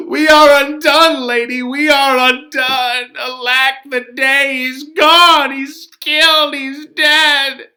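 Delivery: a loud, crying lament from the nurse; wailing nurse. Acted. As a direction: wail the news as the grieving nurse. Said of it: "She comes in here crying, upset".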